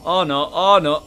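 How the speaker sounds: loud, high "oh no", climbing to a laughing shriek; rising panic. Real, not acted. Reaper approaching, player panics.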